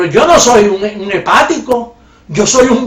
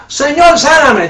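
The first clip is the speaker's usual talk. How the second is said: loud, higher mock begging to God; louder mocking cry of Señor, sáname; mock pleading prayer; 6 semitones higher, 5 dB louder, slower than usual. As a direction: ham up a desperate plea for healing.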